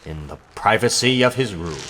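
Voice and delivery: deep announcer voice introducing the next scene; cartoon narrator. A narrator's reading.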